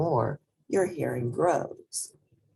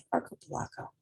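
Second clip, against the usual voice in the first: whispered word practice; whispered to self; 3 semitones higher, 7 dB quieter, much faster than usual. Shown as a demonstration. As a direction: whisper archipelago under the breath. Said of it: "bear with me".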